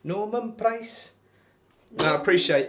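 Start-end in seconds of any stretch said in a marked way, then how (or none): 0.0-1.1 high, drawn-out Welsh caricature; mock Welsh accent. Streamer mimicking Fireman Sam, mock Welsh accent. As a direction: stretch the name in cartoon Welsh. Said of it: "That's just taking the mick".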